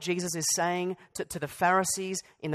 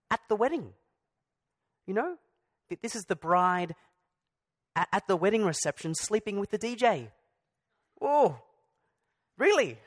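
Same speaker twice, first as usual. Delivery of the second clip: raised, incredulous oh really; mock shock; 4 semitones higher than usual. Preacher to congregation, mock-scandalised disbelief. Mock outrage at the wedding image.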